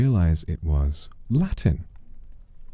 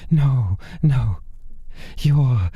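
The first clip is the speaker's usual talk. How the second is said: fanatical pleading man; 13 semitones higher, slower than usual. Acted. Desperate man promises to spread the demon's name, high and fevered. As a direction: gush it all out in fevered devotion.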